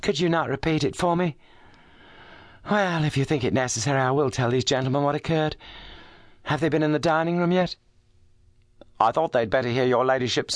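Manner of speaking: a shaken noblewoman holding together; refined distressed lady. A narrator's reading. Lady Brackenstall voiced in story.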